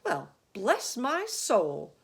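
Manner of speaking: stunned fire chief; astonished cry. A narrator's reading. Fire chief amazed at the boy, slow and loud. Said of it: "cried the head of the fire department".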